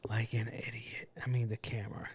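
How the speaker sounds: self-mocking whisper. Real, not acted. Catching himself staring at the mic, whispering. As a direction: whisper it at yourself. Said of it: "looking at this microphone".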